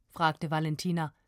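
ordinary delivery

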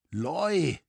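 high-pitched, bittersweet surprise; joyful, pained exclamation. A narrator's reading. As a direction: greet an old friend with joy and pain. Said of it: "unterbrach Roritzer mit freudig schmerzlichem Ausrufe". (freudig-schmerzlicher Ausruf)

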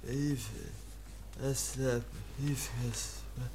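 timid murmur. Acted. Kaspar timidly refusing to hand over the letter. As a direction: shrink away and murmur.

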